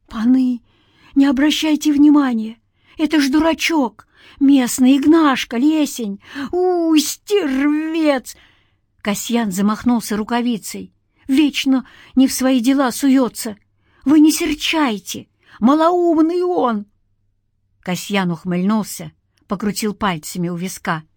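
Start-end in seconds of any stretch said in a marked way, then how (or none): 0.1-8.4 high, urgent, strained pleading; broken-voiced pleading. A narrator's reading. Villager excusing a local fool to spirits, broken-voiced pleading. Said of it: "заговорил сорванным голосом". 11.3-16.9 high, anxious, wheedling excuses; broken-voiced appeasing. A narrator's reading. Villager begging spirits not to be angry, broken-voiced. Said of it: "заговорил сорванным голосом".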